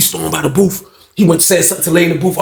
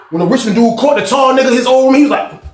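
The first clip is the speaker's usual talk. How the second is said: ordinary delivery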